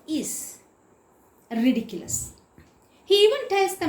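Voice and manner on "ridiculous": normal talking voice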